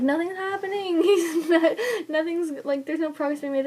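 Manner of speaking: high, half laughing half crying; laugh-crying despair. Retold or imitated. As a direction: wail it between laughs and tears. Retelling despair while pushing.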